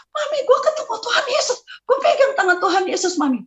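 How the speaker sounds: high-pitched, overjoyed tearful outburst; crying out joyfully. Retold or imitated. Telling mother about meeting Jesus, joyfully crying out. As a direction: blurt the news high and elated. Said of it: "Datang itu saya teriak".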